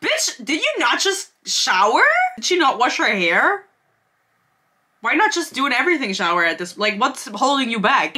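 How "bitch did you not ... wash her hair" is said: incredulous. Real, not acted. Incredulous reaction to book passage.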